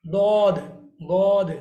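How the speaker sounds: "दौद" loud and heavy, with a faint "दाल" sound under it; correct heavy letter. Shown as a demonstration. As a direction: repeat "दौद" forcefully, side of tongue on molars. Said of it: "इसका actual pronunciation क्या है". (ज़ोरदार सही "दौद")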